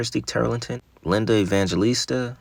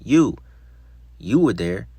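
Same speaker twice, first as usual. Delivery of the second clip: mild yell, the first word punched higher and louder; narrated yell; 3 semitones higher, 7 dB louder, slower than usual. A narrator's reading. Young woman at an officer, mild yell. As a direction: call it out, loudest at the start. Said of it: "She continued yelling".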